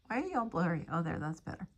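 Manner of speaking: usual speaking voice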